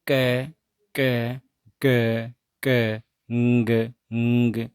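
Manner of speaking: repeated क then a held nasal ng; velar sounds demo. Shown as a demonstration. Teacher repeats velar sounds for students, crisp and clear. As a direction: clip each क, then hum ng. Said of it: "जो soft palate है यहाँ टकराता है".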